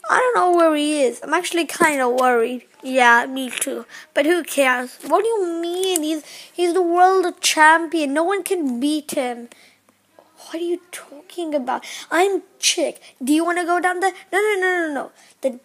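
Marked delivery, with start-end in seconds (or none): none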